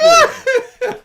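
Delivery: burst of laughter. Real, not acted. Laughing at own bit.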